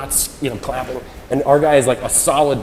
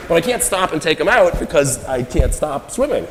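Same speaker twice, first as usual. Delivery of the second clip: ordinary talk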